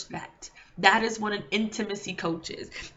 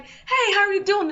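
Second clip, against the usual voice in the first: much higher, cheery hello; bright greeting; 9 semitones higher, 4 dB louder than usual. Retold or imitated. Speaker greeting people on the street, beaming. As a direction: greet a stranger with overflowing joy.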